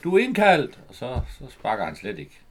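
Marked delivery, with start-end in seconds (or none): 0.0-0.8 a louder, higher call of the news; half-acted yell, like calling across the pitch; retold shout. Woman shouting to the player before his penalty, mock yell. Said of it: "og så råber hun lige inden han sparker".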